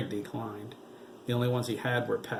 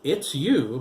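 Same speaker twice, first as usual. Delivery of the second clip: teasing; 4 semitones higher, 8 dB louder than usual. A narrator's reading. Tailor teasing.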